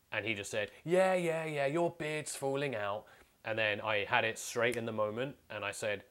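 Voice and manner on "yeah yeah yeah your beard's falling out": high, loud, slow mocking drunk; drunk taunt. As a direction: gloat like a drunk bully. Drunk coworker taunting speaker.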